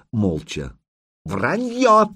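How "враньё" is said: sharp barked shout, much higher; barking. Acted. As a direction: bark the word out. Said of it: "рявкнул Курей".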